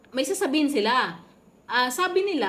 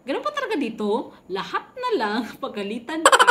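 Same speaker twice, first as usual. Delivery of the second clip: higher, laughing through the complaint; amused protest at being scolded; laughing disbelief; 4 semitones higher, 5 dB louder than usual. Retold or imitated. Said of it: "Sabi ko".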